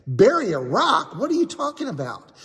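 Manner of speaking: high, quick disbelief; a boy baffled by a strange request; incredulous. Acted. Boy asks the rabbi, baffled and incredulous.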